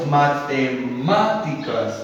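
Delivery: "matemáticas" slowly, syllable by syllable; slow example word. Shown as a demonstration. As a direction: drag "matemáticas" out, stressing MÁ. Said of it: "Por ejemplo". ("matemáticas" lento, marcando la sílaba MÁ)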